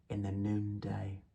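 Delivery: breathy whispered phrase; whispered line end. Acted. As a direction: breathe the words out.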